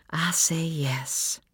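slow, breathed whisper; whispered thought. A narrator's reading. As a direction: whisper it slowly, as a private thought. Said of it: "she thought as he spun her".

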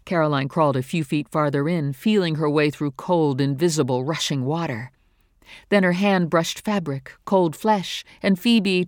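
ordinary delivery, nothing marked

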